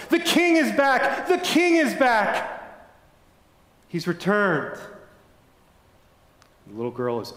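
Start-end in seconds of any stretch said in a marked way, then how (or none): 0.1-1.3 high, slow, ringing cry of joy; joyful shout. A narrator's reading. People rushing out of castle. 1.3-4.8 high, very slow cry of joy; joyful shout. A narrator's reading. People rushing out of castle.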